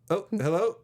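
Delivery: high, rising "is anyone there?" hello; tentative phone hello. Retold or imitated. Acting out a pretend phone call.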